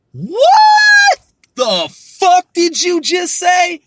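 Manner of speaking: long, high, drawn-out incredulous shout; shocked outburst. Acted. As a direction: yell it in loud, high disbelief, stretching the first word. Imagined outraged reaction, acted.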